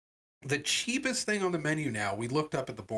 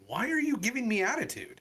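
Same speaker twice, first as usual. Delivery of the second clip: high-pitched, retold indignation; indignant challenge; 9 semitones higher than usual. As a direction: call out rudeness in a raised pitch. Customer challenges huffy counter worker.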